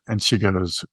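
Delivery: normal talking voice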